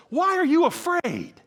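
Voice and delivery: high, loud, irritated demand; ticked. Acted. Jesus asks the disciples, ticked. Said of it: "And I think he's ticked".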